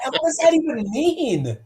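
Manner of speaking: very high-pitched mock exasperation; exasperated bafflement. Rookie baffled by his agent's advice, exasperated. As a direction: squeal it in hammed-up confusion.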